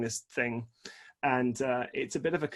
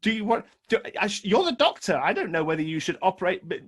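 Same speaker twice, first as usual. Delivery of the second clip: flustered pleading; 4 semitones higher, 3 dB louder than usual. Retold or imitated. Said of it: "I said".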